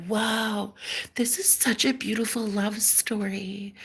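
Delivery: soft, hushed mock wonder; awestruck viewers. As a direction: imitate a dreamy audience in a hush. Imitating awestruck stream viewers.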